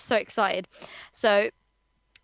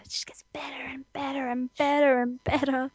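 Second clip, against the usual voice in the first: whispering; 4 dB quieter than usual. Real, not acted. Gushing whisper.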